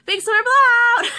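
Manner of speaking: high, loud sing-out down the hall; mom mimicking alarm. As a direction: call it out like a mom joining the joke. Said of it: "my mom's in the hall going".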